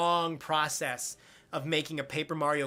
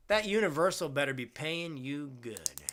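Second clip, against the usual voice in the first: ordinary talk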